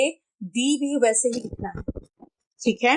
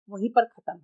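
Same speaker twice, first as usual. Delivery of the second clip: ordinary delivery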